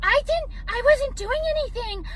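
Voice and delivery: high, fast squeaky mock victim voice; mocking a whiny critic in a high squeak. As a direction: ham up a hysterical innocent critic. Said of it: "the only reason you do it too".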